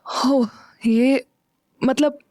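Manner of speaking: drawn-out sighing hesitation, voice catching; weary sigh, then giving in. A narrator's reading. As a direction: let out a long sigh before the words. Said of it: "लंबी सांस छोड़ते हुए बोली".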